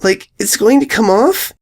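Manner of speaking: high, small filly voice calling her sister; a little girl asking timidly; young filly voice. A narrator's reading. Filly calling her sister, high and small.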